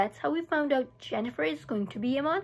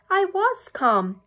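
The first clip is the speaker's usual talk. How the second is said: indignant insistence; 6 semitones higher, 6 dB louder, much slower than usual. A narrator's reading. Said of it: "I said".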